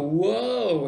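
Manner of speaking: loud, drawn-out "whoa"; mock-astonished exclamation; awed reaction. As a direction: act out being amazed by the description. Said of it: "And we go".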